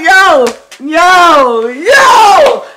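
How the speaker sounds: high, loud yo yo yo; high excited shouts; hype shout. Real, not acted. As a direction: shout hyped. Hyped reacting to song.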